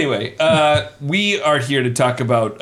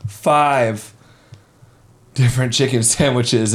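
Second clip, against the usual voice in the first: usual speaking voice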